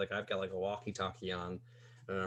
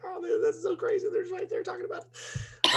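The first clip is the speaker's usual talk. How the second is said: much higher, excited squeal; starstruck squeal; 5 dB louder than usual. Retold or imitated. Fan recalling celebrities nearby, squealing high. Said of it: "I just remember being like".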